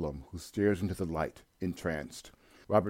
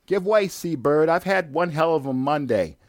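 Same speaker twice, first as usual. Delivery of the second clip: much higher, louder irritated yell; angry shouting; 9 semitones higher, 8 dB louder than usual. Acted. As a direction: bark at the bird to move. Angry order at seagull.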